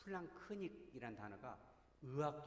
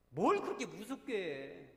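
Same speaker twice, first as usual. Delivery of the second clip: slow, much louder, half-pleading "why so scary"; exasperated rebuke; 3 semitones higher, 9 dB louder, slower than usual. Real, not acted. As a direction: drag it out loudly in protest. Rebuking frightening preachers. (답답하다는 듯 크게 외침)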